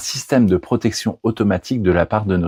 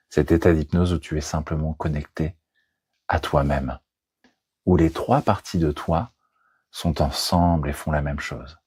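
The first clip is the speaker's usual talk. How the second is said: hypnosis voice; 3 semitones lower, 4 dB quieter than usual. Acted. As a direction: lull the listener gently into trance. Leads a hypnosis session.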